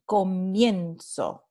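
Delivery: comienzo said slowly with the weight on "mien"; stress on the diptongo. Shown as a demonstration. Teacher pointing out the stressed diphthong, slowly. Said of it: "el diptongo va a formar la sílaba tónica".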